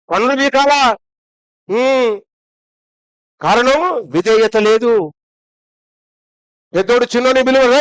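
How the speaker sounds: ordinary delivery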